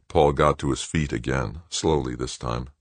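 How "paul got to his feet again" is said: ordinary delivery, nothing marked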